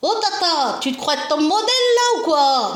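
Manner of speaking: put-on high, loud cheeky little boy; imitating little nephew. Little nephew teasing his aunt about modelling, high child voice. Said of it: "c'est la façon qu'il disait avec sa voix".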